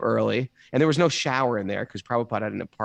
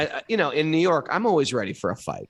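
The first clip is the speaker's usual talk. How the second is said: ordinary delivery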